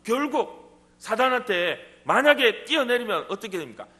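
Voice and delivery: ordinary talk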